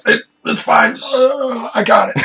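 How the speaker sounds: hammed-up strained reassurance, leaping upward; mock reassurance. Game master voicing the character being sucked by mosquitoes, mock reassurance. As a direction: act out someone struggling, jumping high mid-phrase. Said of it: "He's like".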